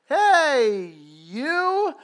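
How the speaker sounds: high, loud fake-friendly greeting; pretending to know him, bright and loud; fake recognition. Pastor greeting a stranger who knows him, fake-friendly. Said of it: "I'm like".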